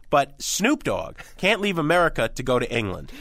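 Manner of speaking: normal talking voice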